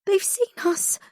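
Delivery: high, frightened voice; panicked. Acted.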